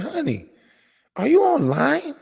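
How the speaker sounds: wife calling him off the stream in a high worried voice; concerned wife. Retold or imitated. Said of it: "That was a concerned voice".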